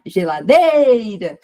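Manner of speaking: "geladeira" called loudly, DEI held long; calling out, stressed syllable stretched. Shown as a demonstration. Teacher imitates calling at the gate to play, DEI of "geladeira" stretched. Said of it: "quando a gente chama no portão pra brincar".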